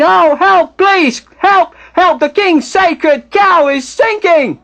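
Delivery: high, loud, drawn-out cries of alarm; screaming for help. Acted. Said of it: "Then Duomo starts screaming".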